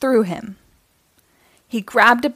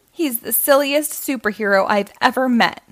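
ordinary delivery

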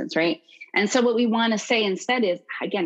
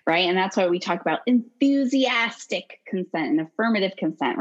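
normal talking voice

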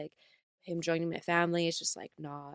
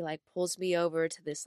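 normal talking voice

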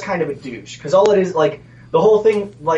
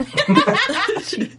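high smug chuckle; cocky laugh. Shown as a demonstration. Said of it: "First you gotta start off with the laugh".

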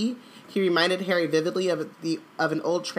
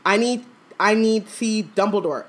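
higher, halting urgent plea; desperate plea; 4 semitones higher, 4 dB louder than usual. A narrator's reading. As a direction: plead haltingly, like a man losing his grip. Desperate Crouch begging for Dumbledore.

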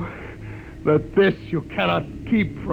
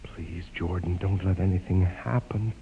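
low, soft, desperate pleading; hushed plea; 10 semitones lower, 5 dB quieter than usual. Acted. Frightened man begs Jordan under his breath, hushed and low. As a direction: beg under your breath.

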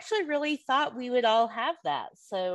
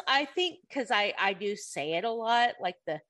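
ordinary delivery

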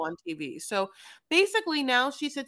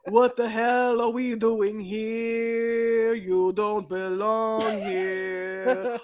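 very slow, louder drawn-out creep voice; hammed-up slow menacing confession; mock creepy Osama; 5 dB louder, much slower than usual. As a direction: drag out each word like a self-aware creep. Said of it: "I wish that he was like".

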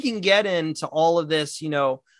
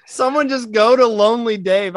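louder, higher mock viewer plea; exasperated plea; 5 semitones higher, 5 dB louder than usual. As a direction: plead aloud at the screen for a lonely player. Acting out own viewer reaction.

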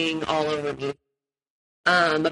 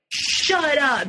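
retold scream; 8 semitones higher than usual. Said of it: "just screams to everyone".

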